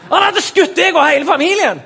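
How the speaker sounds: loud, high mock outburst; hammed-up indignant yell; outraged interrogator. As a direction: explode at a hopeless recruit. Interrogator explodes at objector's answer.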